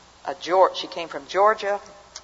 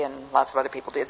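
ordinary delivery

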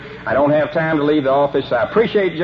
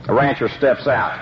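ordinary talk

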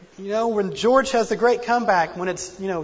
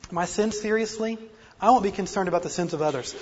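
normal talking voice

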